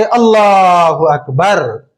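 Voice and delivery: slow, drawn-out takbir going into ruku; prayer takbir. Shown as a demonstration. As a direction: intone it like the imam. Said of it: "सजदे में जाएंगे".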